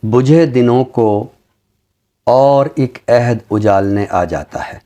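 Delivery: slow, measured ghazal recital with long pauses; poem recitation. Acted. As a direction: savour each line of the couplet, unhurried. Recites a ghazal after introducing it. (धीमी, ठहरी हुई ग़ज़ल की अदायगी)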